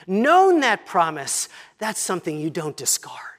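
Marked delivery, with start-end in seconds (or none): 1.8-3.3 hushed whisper; whispered aside to the congregation. Real, not acted. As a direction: drop to a whisper to drive it home.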